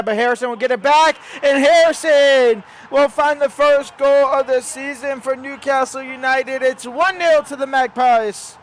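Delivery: excited goal call. Acted. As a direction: roar the goal like a TV commentator.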